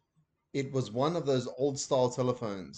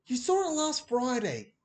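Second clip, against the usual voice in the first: put-on higher, indignant boy's protest; 12 semitones higher than usual. A narrator's reading. Ben protesting to Dad on the phone. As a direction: object like a cheeky child. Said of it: "protested Ben".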